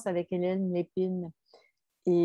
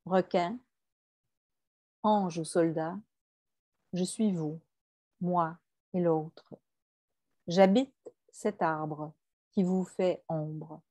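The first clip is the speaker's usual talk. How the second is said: subdued, unhurried verse reading; poetry reading. Acted. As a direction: read each short line apart, letting it settle. Reads a quoted poem aloud.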